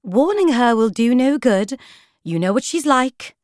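high, sweet, gentle voice; Lady Feroza. A narrator's reading. Flower book dissuading Poisons, sweet and gentle. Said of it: "the sweet, gentle voice".

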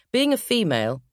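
usual speaking voice

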